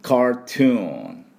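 ゆっくり高めに「カートゥーン」; 「カートゥーン」の各音節を長く伸ばす; 英語発音の手本. Shown as a demonstration. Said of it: "なんて言うかというと". (英語風に「カートゥーン」、最後のNまでゆっくり)

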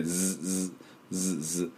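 soft short "ズ" buzzes; quiet repeated z on "ズ"; short buzzed z. Shown as a demonstration. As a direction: buzz "ズ" briefly, voiced z. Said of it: "Zになりまして".

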